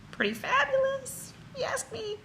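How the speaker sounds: sing-song high voice, teasing; lilting high, pleased with herself; playful high aside. Acted. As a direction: toss it off high and cheeky. Playful aside after pep talk.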